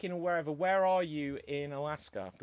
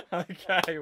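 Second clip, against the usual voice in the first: laughing; 4 semitones higher, 7 dB louder than usual. Real, not acted. Host laughs at performance.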